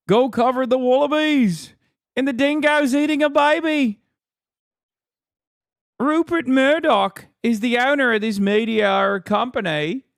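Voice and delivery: broad mock-Aussie jeer; mock Australian accent. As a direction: heckle in a put-on Australian accent. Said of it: "can't tell if it's you talking".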